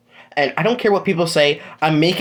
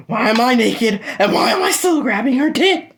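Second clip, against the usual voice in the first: higher, laughing panic; rising panic; 8 semitones higher than usual. Acted. Deku finding himself naked beside Sue, laughing panic. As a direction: spiral into flustered panic.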